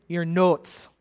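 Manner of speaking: imitated Minnesota long O in "notes"; lightly put-on northern Minnesota accent. Classmate from north of the Twin Cities, Minnesota accent. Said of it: "because their accent betrayed them".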